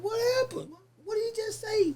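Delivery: very high-pitched astonished questions; alarmed disbelief. Retold or imitated. Defendant anxious to hear the bailiff's news, high astonished questioning. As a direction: squeak it out like stunned disbelief, retold.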